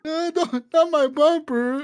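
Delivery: high, slow pathetic whine; whiny smuggler. Retold or imitated. Smuggler begging the cop to spare his bumper, high slow whine.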